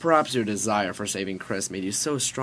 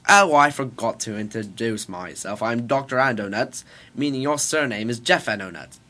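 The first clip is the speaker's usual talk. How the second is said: louder hammy British professor; bad British accent; 7 dB louder than usual. Scientist introduces himself to his son, bad British accent. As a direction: introduce yourself in mock British. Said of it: "I'm doing a British accent".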